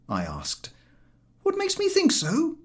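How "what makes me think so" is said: high, indignant retort. A narrator's reading. George turning on the narrator, indignant. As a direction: throw the question back. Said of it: "retorted George".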